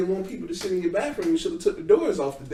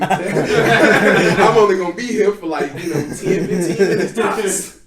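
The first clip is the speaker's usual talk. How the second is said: laughing; 4 semitones higher, 8 dB louder, slower than usual. Retold or imitated. Retold own excuse, laughing.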